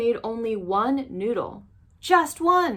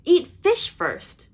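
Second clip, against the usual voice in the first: high, loud and slow; girl urging the monster to eat; urgent plea; 10 semitones higher, 6 dB louder than usual. Acted. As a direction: press the point hard.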